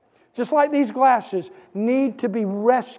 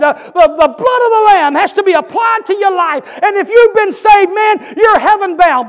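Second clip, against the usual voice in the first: loud, high, driving sermon voice; preaching at full force; 6 semitones higher, 8 dB louder than usual. Acted. Pastor urges Gateway Church to hear him, full-force preaching. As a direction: preach it out to the whole room.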